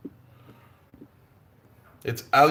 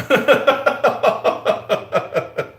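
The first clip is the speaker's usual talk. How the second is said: airy high giggle; breathy laugh; 11 semitones higher, 5 dB louder than usual. Real, not acted. Joking that Mick suffers her humour, a breathy laugh. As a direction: laugh it out under your breath.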